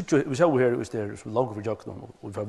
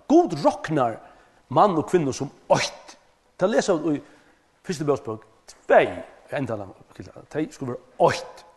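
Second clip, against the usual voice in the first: loud, higher preaching voice, pressing on; full-force preaching; 6 semitones higher, 8 dB louder than usual. Acted. Preacher stresses God's promise to Abraham, loud and fervent. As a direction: proclaim it over the congregation.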